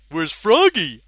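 high, loud dopey call; Big the Cat voice. Pretend.

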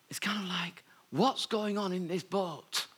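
breathy whisper of disbelief; hushed, bemused whisper asking what's happening; whispered aside. Real, not acted. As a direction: lean in and whisper it, puzzled. Bemused aside about the disciples.

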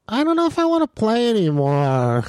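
put-on high, whiny kid complaint; Jackson. Imitating his son's friend wanting to quit the game, high whine. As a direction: whine it high and drag out the last word. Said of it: "You know, that's Jackson".

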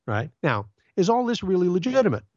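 ordinary talk, nothing marked

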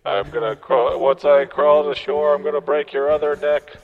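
high, threatening ghost voice; high menacing ghost. Pretend. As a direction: threaten him in a high squeaky voice.

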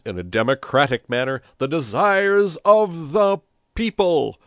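loud, high rabble-rousing oratory; fiery, declamatory political speech; shouted speech. A narrator's reading. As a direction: proclaim it to a crowd from a balcony. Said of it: "shouted Pepone".

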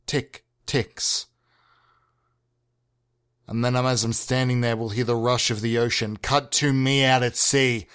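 ordinary talk

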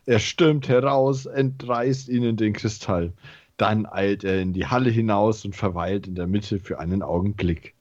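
ordinary talk

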